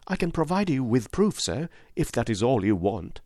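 dignified widow; great dignity. A narrator's reading. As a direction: stand tall as you offer it. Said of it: "with great dignity".

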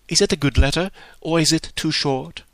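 put-on high, nervous young voice; anxious question. Harriet anxiously asks Emma about the letter, high and nervous. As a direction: beg to know the verdict. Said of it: "forced to add".

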